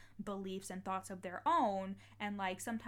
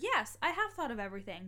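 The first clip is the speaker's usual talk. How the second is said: louder, mock-insistent comeback; emphatic retort; 3 semitones higher, 6 dB louder, faster than usual. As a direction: fire back at grandma, retelling it emphatically. Retold firm retort to grandmother.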